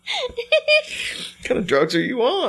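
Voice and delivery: high, slow words through laughter; barely gets it out while cracking up; laughing hard. Real, not acted. Cracks up at chili puddle.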